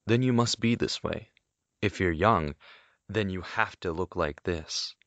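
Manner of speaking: usual speaking voice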